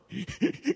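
quick amused laugh; a bright little laugh; short laugh. Acted. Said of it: "And the salesman laughed".

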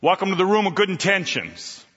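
usual speaking voice

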